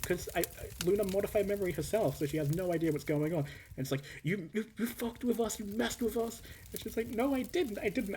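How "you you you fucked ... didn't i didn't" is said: high-pitched retelling. Retold or imitated. Retelling the dagger scene, high and giggly. Accusation and denial, voiced.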